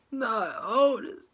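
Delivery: high, loud, slow wail of grief; despairing cry. Real, not acted. Vlogger grieving for Otis, slow high loud wail. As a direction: drag it out like your heart just broke. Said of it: "It was so devastated".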